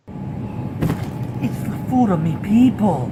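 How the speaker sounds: loud, slow, hammed-up Irish brogue; mock Irish. Joking to viewers about Irish food in a slow, loud mock Irish accent. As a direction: draw it out in a jokey Irish accent. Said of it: "my lovely Irish accent".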